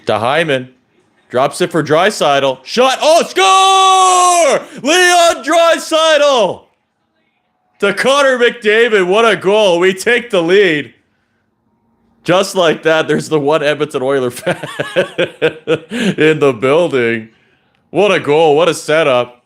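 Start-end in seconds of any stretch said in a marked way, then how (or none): 2.6-6.7 huge high goal shout, stretched out; long goal yell. Real, not acted. Goal scored, fan commentary. 7.8-19.4 excited celebration. Real, not acted. Celebrating the goal.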